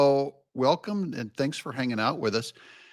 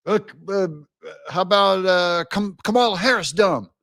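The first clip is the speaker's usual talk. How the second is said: higher, halting try at a new slogan; mock tentative pitch; 5 semitones higher, 3 dB louder than usual. Democrat testing a new slogan on the crowd, mock hesitant. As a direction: fumble toward it, then push it out. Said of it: "Then he starts going".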